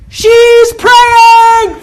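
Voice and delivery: very high, very loud shout from afar; yell. Retold or imitated. Stranger shouting to the massage lady across the lot, yelled. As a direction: call it across the parking lot. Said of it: "I hear someone yell".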